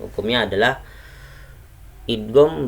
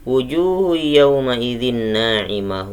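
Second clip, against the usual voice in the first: chanted verse, raised a little; Quran recitation; 3 semitones higher, 4 dB louder than usual. Shown as a demonstration. Teacher reciting verse eight to the class. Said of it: "ayat yang kedelapan".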